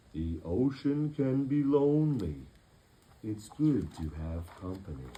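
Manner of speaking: slow, lightly voiced whale; deep slow whale voice. Whale speaking slowly to the crew, lightly voiced. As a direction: drawl it like a gentle whale. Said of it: "in a deep, slow whale voice".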